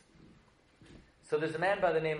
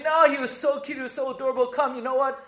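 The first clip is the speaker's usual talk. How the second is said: higher, mildly gushing daughter; a hint of a coaxing daughter; lightly voiced pleading daughter; 7 semitones higher, 5 dB louder than usual. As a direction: plead playfully with dad, pitched up. Daughter acted, pleading with Pharaoh.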